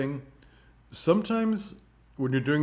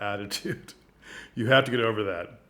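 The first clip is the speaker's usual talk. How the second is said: usual speaking voice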